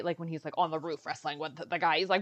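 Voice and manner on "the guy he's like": ordinary talk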